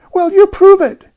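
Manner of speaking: put-on very high, loud child's dare; dogged challenge. A narrator's reading. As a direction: challenge her stubbornly. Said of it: "challenged Jane doggedly".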